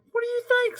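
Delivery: very high, mocking boy voice; cheeky boy. A boy answering Sammy in the school line. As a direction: sass it like a little boy. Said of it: "said a boy".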